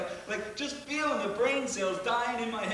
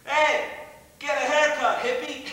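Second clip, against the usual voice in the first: higher and much louder jeering yell; mocking shout; 6 semitones higher, 8 dB louder than usual. The boys jeer at the hippie left on the highway, shouting loud. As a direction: yell it out the window at him. Taunting the beaten driver.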